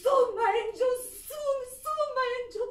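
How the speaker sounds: high and loud, tearful call; crying out. Real, not acted. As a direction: cry out comfort to him. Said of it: "I'm crying and screaming with him".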